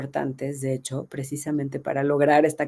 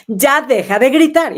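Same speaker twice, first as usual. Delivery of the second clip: loud, higher shouted order to stop yelling; parent yelling; 6 semitones higher, 9 dB louder than usual. Retold or imitated. Parent yelling at the kids to stop yelling, loud. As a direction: yell it the way parents do without thinking. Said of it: "el grito es más rápido, nos sale en automático".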